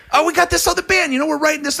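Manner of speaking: lightly voiced eager songwriter; imitating Joey. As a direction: play him casually proud of the song. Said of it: "I'm like".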